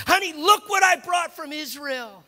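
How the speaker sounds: high, excited shout; excited husband. Retold or imitated. Husband showing his wife what he brought from Israel, excited shout. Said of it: "He's so excited".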